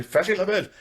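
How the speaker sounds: high silly voice; lightly voiced movie quote; mock Monty Python voice. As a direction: squeal it like a comic knight. Quoting Monty Python scene.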